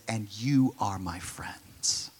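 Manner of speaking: slow whispered line. Acted. Jesus whispers to the twelve apostles. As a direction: whisper it tenderly to close friends.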